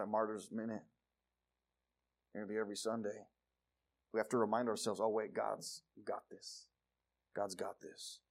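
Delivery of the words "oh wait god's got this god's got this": soft, slow self-reassurance; calm yourself down quietly; reassuring. Pretend.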